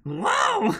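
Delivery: squeaky high, hammed-up wow; mock amazement. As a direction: overplay the awe like a cartoon sidekick. Voicing a character's amazed cry.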